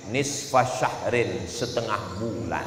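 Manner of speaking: soft, slow reveal; hushed reveal. Acted. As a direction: drop the voice for the answer. Dramatic reveal.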